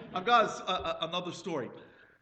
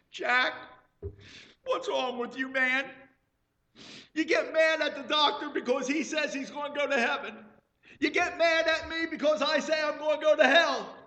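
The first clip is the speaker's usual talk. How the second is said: higher, loud, shouting rebuke; loud scolding; 11 semitones higher, 7 dB louder than usual. Retold or imitated. Wife shouting at her husband in the car. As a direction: shout the sense into him.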